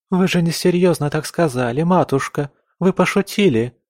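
high, dismayed protest; a mild outcry of disbelief; crying out. A narrator's reading. Camilla begs mother to say she was joking, crying out. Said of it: "закричала Камилла".

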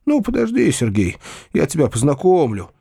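ordinary delivery

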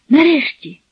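high, eager burst of relief; finally, she's been waiting so long; excited cry. A narrator's reading. Girl rushing to her returning lover, excited cry. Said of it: "кинулась до нього".

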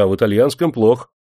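ordinary delivery

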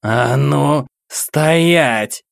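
loud, high furious roar; growled. Acted. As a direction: roar at him to halt. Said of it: "прорычала женщина". (яростный рык)

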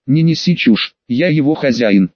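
ordinary delivery

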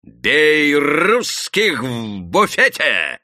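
drawn-out high, loud yell; вопль. A narrator's reading. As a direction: wail out the call to attack. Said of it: "завопил губастый парень". (протяжный истошный вопль)

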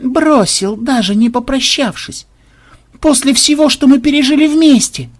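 high, hammed-up heartbreak; wounded lament. A narrator's reading. Programmer laments the crocodile left him.

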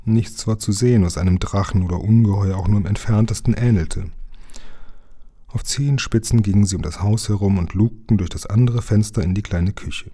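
plain reading voice

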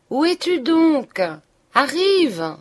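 loud high shout, slow on the question; loud impatient call. A narrator's reading. Charles shouting up for his wife, loud and impatient. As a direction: yell up the stairs for her to come.

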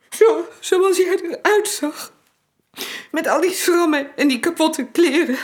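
tearful breakdown. Acted. Widow breaking down in front of Max, tearful. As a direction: let the grief break through.

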